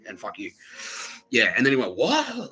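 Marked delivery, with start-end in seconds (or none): 2.0-2.5 high, drawn-up cry of disbelief; imitating someone taken aback; mock shock. Colleague stunned by the teller's rant, mock high shock. Acts other man's shocked reaction.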